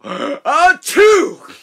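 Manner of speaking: very high loud mock sneeze; huge gasp-and-sneeze. Engine sneezing at the boys, huge mock sneeze. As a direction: wind up and blast the sneeze.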